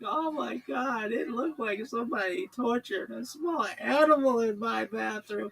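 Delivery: high, loud, slow distressed wail; panicked caller. Retold or imitated. Caller wailing to the host in panic. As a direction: moan it out in horror.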